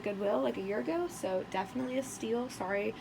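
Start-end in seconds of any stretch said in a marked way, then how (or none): none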